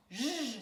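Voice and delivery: J as a sustained "zh" buzz, not the letter name; consonant sound. Shown as a demonstration. Teacher isolating the first sound of journée, J buzzed. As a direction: hold J as a pure "zh". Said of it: "Je prononce les sons".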